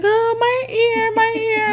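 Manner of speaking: high, slow mock whine of a hurt child; drawn-out, high whimpering imitation; imitated crying child. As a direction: wail it like a child in pain. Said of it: "crying, all this commotion".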